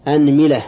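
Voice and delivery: "anmila", opening on a with i after the m; fatha, then kasra. Shown as a demonstration. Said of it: "هذا في الفتح". (أنملة بفتح الهمزة وكسر الميم)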